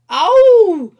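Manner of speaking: put-on a little girl howling as she hits the step; sharp, shrill-pitched ouch; child's cry of pain. Sarah falls on the steps.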